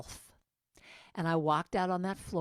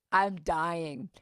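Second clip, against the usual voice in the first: normal talking voice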